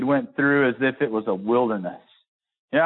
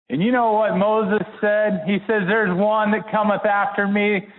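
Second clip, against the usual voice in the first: preaching at full force; 8 semitones higher than usual. Acted. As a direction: lift the church with the question. Preacher rising again.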